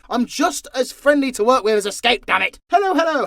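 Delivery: usual speaking voice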